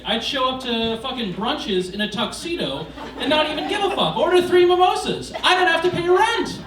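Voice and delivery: excited. Acted. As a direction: build to a giddy shout. Excited fantasy, rising to shout.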